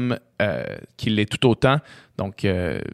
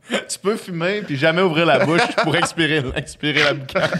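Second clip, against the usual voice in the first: laughing; 5 semitones higher than usual. Real, not acted. Laughing at smoking joke.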